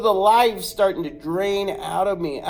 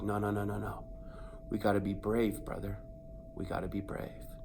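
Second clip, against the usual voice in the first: hushed cousin; 8 semitones lower, 11 dB quieter, faster than usual. Retold or imitated. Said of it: "He's like".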